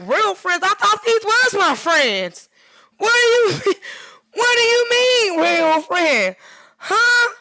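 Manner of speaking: high, loud, disbelieving cry of real friends; shocked and wounded, pitch way up; incredulous outburst. Retold or imitated. To the friend who said God removed them, loud disbelief. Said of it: "And I'm like".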